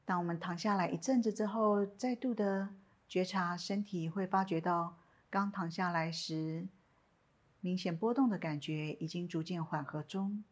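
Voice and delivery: calm, unhurried meditation guidance with long pauses; guided meditation. Acted. Teacher guiding listeners lying down in a body scan, calm meditation voice.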